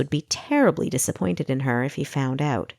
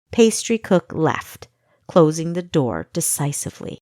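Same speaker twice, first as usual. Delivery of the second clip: ordinary delivery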